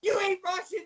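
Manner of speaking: high-pitched accusation; incredulous. Retold or imitated. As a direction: blurt it out in mock disbelief. Stunned discovery, retold.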